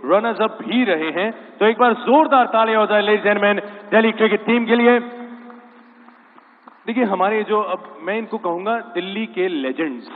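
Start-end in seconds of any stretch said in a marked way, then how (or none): 1.6-5.0 loud, higher-pitched emcee shout; rally the crowd for a big hand; calling for applause. Real, not acted. Host calls on the crowd to applaud the cricket team, loud and rousing.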